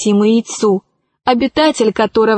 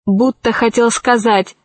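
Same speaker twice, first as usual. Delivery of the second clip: plain reading voice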